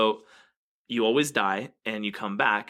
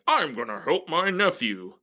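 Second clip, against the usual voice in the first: higher, put-on grand voice; helpful god; 6 semitones higher than usual. God vowing to rescue a relative, high put-on voice. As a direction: announce it as a hammed-up deity. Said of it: "They're like".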